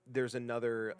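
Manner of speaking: ordinary talk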